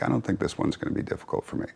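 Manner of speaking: soft, fast, breathy whisper; mock confidence. Mocking his past self's cocky audiobook confidence in a quick whisper. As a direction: rattle it off in a smug quiet aside. Recalling overconfidence about audiobook.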